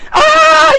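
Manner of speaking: sudden high loud cry of shock; a stunned, very high yelp; shocked gasp. Retold or imitated. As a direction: gasp like it's all hitting you at once. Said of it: "like, in a state of shock".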